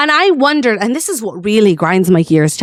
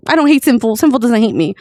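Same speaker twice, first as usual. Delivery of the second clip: usual speaking voice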